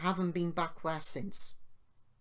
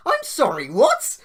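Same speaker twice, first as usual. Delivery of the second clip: high, loud incredulous "what?"; real outrage breaking into a squeak; storyteller reliving the insult, shrill with disbelief; stunned disbelief; 10 semitones higher, 15 dB louder than usual. Real, not acted.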